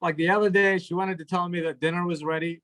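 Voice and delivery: ordinary talk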